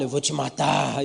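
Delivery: low, slow demonic threat; lightly voiced possessed man, drawn out. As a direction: pastor imitating the possessed man threatening him. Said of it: "Um cara fica endemoniado".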